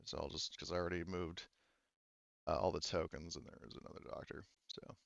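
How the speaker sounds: very soft, low aside, trailing into a whisper; muttered aside. Real, not acted. Game master mumbles housekeeping about moving tokens, muttered aside. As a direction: drop low, fading to near whisper.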